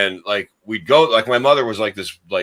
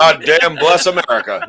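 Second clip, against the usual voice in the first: louder, higher mock old-man proclamation; old-timey old man; 5 semitones higher, 7 dB louder than usual. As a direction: proclaim it like a cussing old patriot. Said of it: "that's how these old men sounded".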